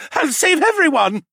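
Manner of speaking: high-pitched loud cry; bellowing. A narrator's reading. Captured mage promises to save all, bellowing. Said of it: "the mage bellowed".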